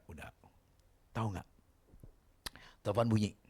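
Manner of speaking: ordinary talk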